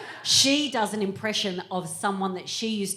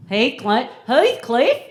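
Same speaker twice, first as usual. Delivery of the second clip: high, loud questioning echo; incredulous echo; 5 semitones higher, 8 dB louder, slower than usual. Acted. Comic echoing the guest's film name, incredulous. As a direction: repeat it louder and higher, as a question.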